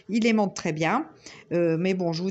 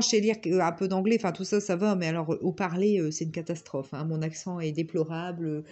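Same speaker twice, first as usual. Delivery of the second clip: ordinary delivery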